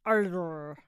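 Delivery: creaky vocal-fry noise, breaking into a laugh; mock vocal-fry growl. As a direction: imitate her fry with a drawn-out croak. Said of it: "she has a vocal fry thing".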